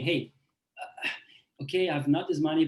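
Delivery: ordinary delivery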